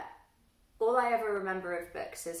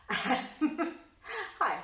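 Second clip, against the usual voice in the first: laughing hello; 10 semitones higher, 5 dB louder than usual. Real, not acted. Awkward laughing self-introduction.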